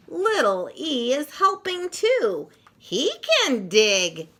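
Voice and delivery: lively picture-book read-aloud with punchy sound words; reading to children. Acted. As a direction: read it like story time for little kids, full of bounce.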